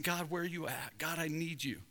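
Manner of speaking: soft, searching prayer; acted hushed pleading; quiet plea. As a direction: call out softly to a silent God. Acts out calling on absent God.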